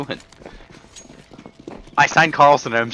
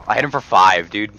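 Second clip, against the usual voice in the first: normal talking voice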